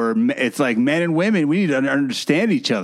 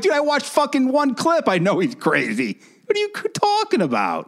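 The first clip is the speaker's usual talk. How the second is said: high-pitched disbelieving outburst; incredulous retort; 10 semitones higher than usual. Retold or imitated. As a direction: voice leaps up in exasperated disbelief. Incredulous retort to the man's excuse.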